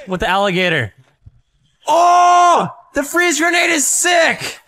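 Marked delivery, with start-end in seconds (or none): 1.9-4.6 high, loud, slowed hype; excited shout. Real, not acted. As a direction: shout it out thrilled. Excited game reaction.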